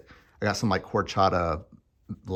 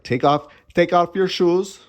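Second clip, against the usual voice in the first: higher, louder, barked order in a fake German accent; mock German accent; 9 semitones higher, 7 dB louder than usual. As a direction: play a stern German shoe clerk, hammed up. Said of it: "she wasn't German".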